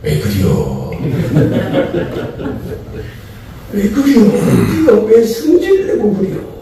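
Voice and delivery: playful Chungcheong dialect God, laughing; God asking in a teasing Chungcheong drawl. Pretend. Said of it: "충청도식으로 말하면".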